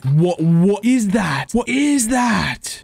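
drawn-out disbelief, climbing high; slow, stretched words of amazement; astonished. Real, not acted. Sees something strange in game.

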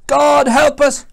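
high, very loud plea; acted desperate shout; second sailor's panicked cry. A narrator's reading. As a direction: yell for help in a storm. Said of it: "cried another".

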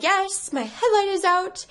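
high-pitched imitation of herself mid-meltdown; mock tearful reply. Retelling her answer to the cop, mock tearful. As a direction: pitch it way up, as if barely holding it together. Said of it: "I just burst out crying".

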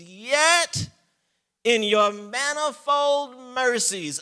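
high, loud, slow proclamation; preaching at full force. Acted. Preacher proclaiming mercy to the church at full force. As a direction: ring out God's mercy.

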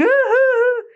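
high-pitched imitation of stifled sobbing; exaggerated trying-not-to-cry wail; mock whimpering. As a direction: whimper as if fighting back tears, played up. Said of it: "trying hard not to cry, really, like".

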